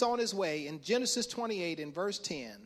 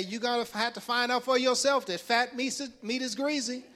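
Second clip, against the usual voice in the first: raised, emphatic elder's warning; old folks; 5 semitones higher, 5 dB louder than usual. Acted. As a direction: preach the old saying like a scolding elder. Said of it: "Old folks used to say".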